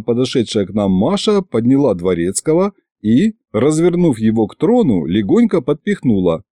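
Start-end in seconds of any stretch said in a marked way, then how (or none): none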